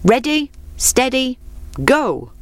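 high starting call. Acted. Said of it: "the Fox called".

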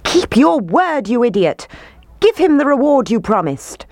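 high, indignant crowd shout; angry roar. A narrator's reading. Townspeople roaring at the mayor, angry demand. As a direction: shout the demand, pitched right up. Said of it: "The townspeople roared".

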